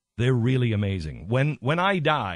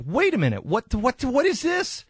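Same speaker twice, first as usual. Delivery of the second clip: rising higher and higher in retold alarm; alarmed disbelief; 11 semitones higher than usual. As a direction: replay the family's startled what-is-this, climbing in pitch. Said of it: "the family was like".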